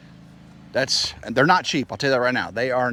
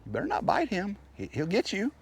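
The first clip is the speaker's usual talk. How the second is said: high playful warning; 10 semitones higher than usual. Real, not acted.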